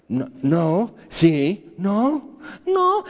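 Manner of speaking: mock whine, pitch jumping way up on the last no; squeaky mock protest, stammering no; flustered whining. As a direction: play a cornered believer starting to whine. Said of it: "Y al rato ya estás chillando".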